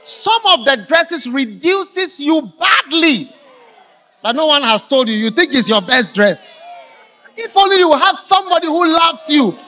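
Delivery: loud high shouting sermon; full-force preaching. Acted. Preacher warning the girls about dresses, shouting. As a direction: preach it hard at the girls, slowing at the end.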